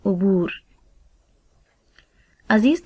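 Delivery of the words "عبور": عبور said as u-boor, u on ع and on ب, و and ر closed; careful pronunciation. Shown as a demonstration. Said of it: "اس کا تلفظ کچھ اس طرح سے ہوگا".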